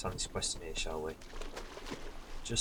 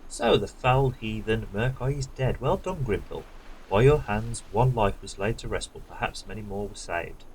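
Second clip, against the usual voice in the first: lightly dramatic quest-giver reading, louder at the start; quest text read aloud; 5 dB louder than usual. Acted. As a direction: give the NPC's words a mild heroic sweep. Reads NPC quest text.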